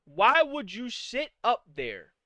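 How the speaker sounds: ordinary talk, nothing marked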